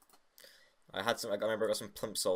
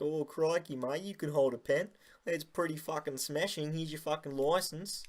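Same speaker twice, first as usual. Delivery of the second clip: higher, put-on Aussie twang, hammed-up; mock Australian accent; 6 semitones higher than usual. Teacher mock-praising a kid, jokey Australian accent.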